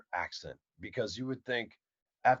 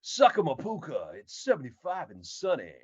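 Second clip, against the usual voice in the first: loud, higher mock radio voice; self-loving radio announcer; 3 semitones higher, 5 dB louder than usual. Shown as a demonstration. Host mocking vain radio guys, puffed-up announcer voice. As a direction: relish the sound of your own voice. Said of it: "in love with hearing the sound of their voices".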